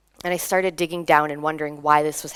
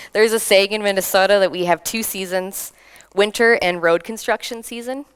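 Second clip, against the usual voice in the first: ordinary talk, nothing marked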